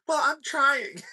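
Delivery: mocked girlfriend pleading high and loud; whiny girlfriend. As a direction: whine it like a girlfriend pushed too far. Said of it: "she'll be like".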